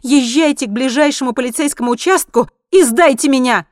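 high, loud dare; affronted, forceful outburst; indignant. A narrator's reading. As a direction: challenge the driver in outrage. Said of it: "с негодованием ответила женщина".

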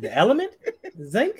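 high, laughing, puzzled question; retold confusion. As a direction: ask it in amused bafflement. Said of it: "I said".

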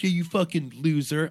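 retold jeer, lightly put on; mock trash talk. Player jeering at his opponent over the mic, mock trash talk.

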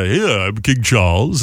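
put-on slow, lofty old-money Boston drawl; posh Boston Brahmin. Host mimicking upper-crust Massachusetts talk, slow and lofty. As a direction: play a stuffy 1920s Massachusetts politician. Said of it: "it's kinda like that".

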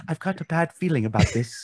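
C-3PO. Acted. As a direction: quote it as a fretful robot, softly. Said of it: "I was going with C three P O".

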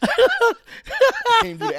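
words squeezed out through high laughter; laughing hard. Real, not acted. Friend admits she cannot do the accent, laughing. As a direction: laugh through the whole line.

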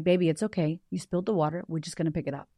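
put-on low, soft reassurance to a child; gently calm a frightened little one; quiet retold comfort, easing down; soothing.

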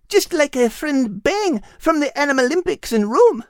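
put-on high, eager girl's voice rattling off a list; a thrilled little girl listing every animal; excited young girl character. Kate lists the animals.

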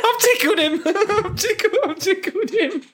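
high-pitched giggling through words; can barely speak for laughing; helpless laughter. Real, not acted. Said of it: "Oh God!".

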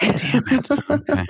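high squeaky damn it; mock dismay. Real, not acted. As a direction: play up the disappointment. Accent joke lands, mock dismay.

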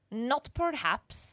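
a hint of French accent; slow, lightly French. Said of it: "he has a really thick French accent".

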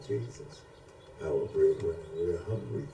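ordinary talk, nothing marked

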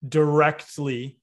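directly said slowly, every syllable weighed; directly, slow and emphatic; stress on directly. Shown as a demonstration. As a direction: lean hard on directly and draw it out. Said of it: "emphasis on directly".

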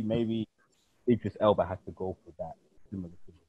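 ordinary talk

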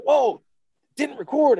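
loud, high, aghast outburst; astonished retold outburst; stunned disbelief. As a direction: cry out in shock, pitch way up. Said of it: "think of his face when he's like".

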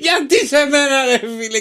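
hammed-up weepy voice, mostly high; crying skinny guy. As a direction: play the sad little guy crying into his drink. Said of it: "και βάζει τα κλάματα".

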